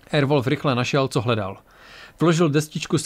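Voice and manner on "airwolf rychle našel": plain reading voice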